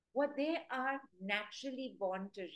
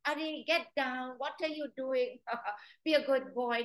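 mixed scold, louder, with a laugh; half-hearted scolding; 3 semitones higher, 6 dB louder than usual. Retold or imitated. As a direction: imitate owners scolding with a giggle. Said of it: "what most people".

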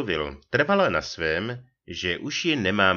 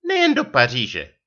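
higher, louder retort with a falling end; manželčina odseknutá odpověď; 5 semitones higher, 7 dB louder than usual. A narrator's reading. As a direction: fire it back as the offended wife. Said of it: "otušila". (dotčená odpověď manželky)

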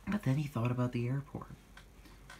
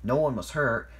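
ordinary delivery

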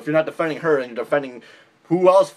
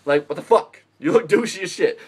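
usual speaking voice

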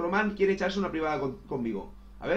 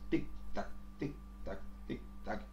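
ticking whisper; 8 semitones lower, 10 dB quieter than usual. Acted. Ticking while waiting for match.

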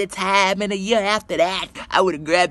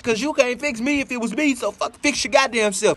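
ordinary delivery

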